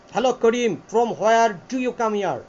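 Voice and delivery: slow British accent, each word of "from where do you" separated. Shown as a demonstration. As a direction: spell out "where do you" slowly in British style. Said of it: "এটা হচ্ছে টাটা system".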